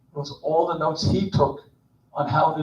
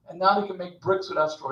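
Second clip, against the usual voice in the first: ordinary talk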